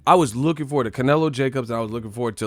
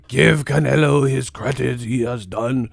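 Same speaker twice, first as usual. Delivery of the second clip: hammed-up villain-style declaration; mock Wolfenstein voice; 2 semitones higher, 7 dB louder than usual. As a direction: declare it like a stern old officer. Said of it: "shut your Wolfenstein ass up".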